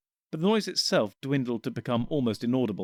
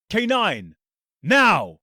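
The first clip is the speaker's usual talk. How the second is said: louder command on now; sudden shout; 8 semitones higher, 8 dB louder, much slower than usual. Acted. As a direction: bark the order at the robot dog. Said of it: "the Doctor suddenly shouted".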